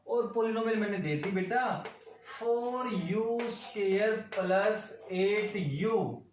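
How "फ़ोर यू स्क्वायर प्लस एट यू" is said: teacher to students, dictating the polynomial slowly; slow dictation. Shown as a demonstration. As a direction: spell the polynomial out very slowly, term by term.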